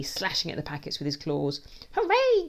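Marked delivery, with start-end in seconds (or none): 1.9-2.5 high, loud hooray; gleeful cheer. A narrator's reading. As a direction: cheer like a rowdy henchman. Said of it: "cheer Plague One and Two".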